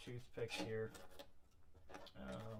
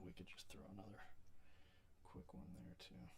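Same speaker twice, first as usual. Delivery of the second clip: whispered aside; 4 semitones lower, 12 dB quieter than usual. Real, not acted. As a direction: drop into a whisper. Drops to a whisper.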